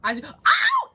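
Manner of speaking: sharp loud yelp of pain; sudden ow. Real, not acted. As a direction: cry out as if something hurt. Sudden pain mid-sentence.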